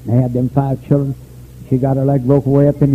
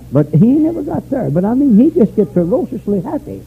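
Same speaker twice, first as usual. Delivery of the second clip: high-pitched preaching; 8 semitones higher, 3 dB louder than usual. Acted. Preaching at full force.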